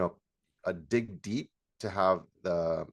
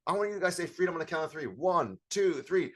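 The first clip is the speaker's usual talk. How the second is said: high, rapid build-up into a countdown; pitched-up workshop host before a group shout; hyping up a crowd; 8 semitones higher than usual. Shown as a demonstration.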